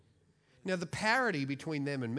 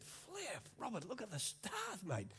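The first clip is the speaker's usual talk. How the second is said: awed exclamation; 6 semitones higher, 8 dB quieter, slower than usual. Retold or imitated. Awed at the night sky.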